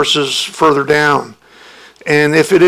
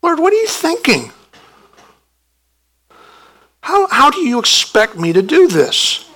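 whining believer; 6 semitones higher than usual. Pretend.

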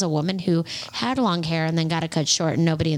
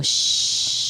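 voiceless shush; mock shush to a man; hush. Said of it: "and go".

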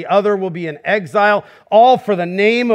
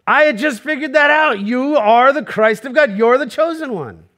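higher, eager retelling of Peter; light Peter voice, excited he already knew; a hint of eager Peter; Peter's reply; 4 semitones higher than usual. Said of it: "And Peter goes".

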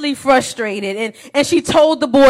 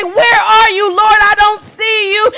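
higher, pleading search for God; a raised, searching lament; anguished plea; 8 semitones higher, 3 dB louder than usual. Pretend. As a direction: cry out for a God you can't find. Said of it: "And you say".

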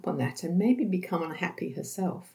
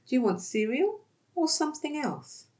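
bright, much higher, friendly question; offering a choice; 9 semitones higher than usual. Shown as a demonstration. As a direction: offer the choice cheerfully to a child.

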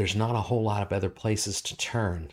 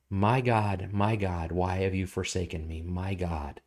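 much softer, sinking to a near hush; hushed cry; 4 semitones lower, 6 dB quieter than usual. Acted. Pastor echoing David's cry softly, fading away. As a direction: let the cry fade into anguish.

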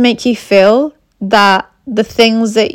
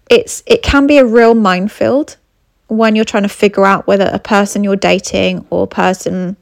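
ordinary talk, nothing marked

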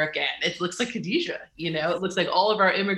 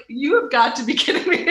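"You have got to be kidding me" laughed out, higher and louder; laughing disbelief; 6 semitones higher, 5 dB louder than usual. Real, not acted. Guest seeing the giant spice bucket, laughing in disbelief. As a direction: laugh through the words in happy disbelief. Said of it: "I was like".

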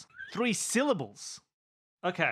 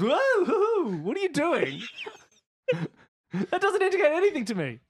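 laughing in disbelief, voice jumping high; 8 semitones higher, 3 dB louder than usual. Real, not acted. Player laughing at his friend's wild gestures. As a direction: crack up while asking what he's doing.

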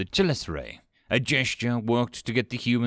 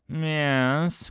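drawn-out, higher, wary reply; suspicious; 6 semitones higher than usual. A narrator's reading. Kolch waits for Steve's excuse, suspicious. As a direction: stretch the word with suspicion. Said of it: "said suspiciously".

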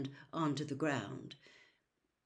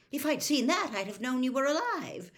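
anxious mother; 8 semitones higher, 5 dB louder than usual. A narrator's reading. Molly upset at the news.

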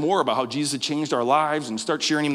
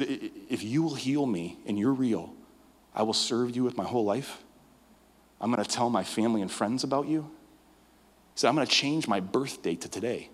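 soft earnest vow; 4 semitones lower, 5 dB quieter than usual. Retold or imitated. Ren's desperate prayer.

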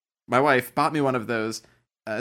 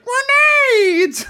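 very high, loud mimicking shriek; hammed-up high-pitched yell; exaggerated Fester imitation; 9 dB louder than usual. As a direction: mimic it as a piercing, drawn-out cartoon shout. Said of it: "You did a, a spot-on impression".

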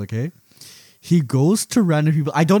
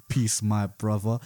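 low, slow, soft solemn greeting; 5 semitones lower, 6 dB quieter, slower than usual. Retold or imitated.

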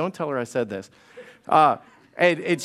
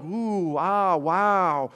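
mock awe; much slower than usual. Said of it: "everybody else was standing around like".

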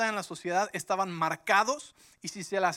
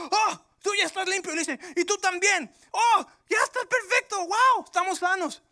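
much higher, loud overjoyed exclamations; joyful amazement; 12 semitones higher, 8 dB louder than usual. Acted. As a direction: burst out in astonished joy. Leprosos descubren que están sanos. (asombro eufórico, agudo y fuerte)

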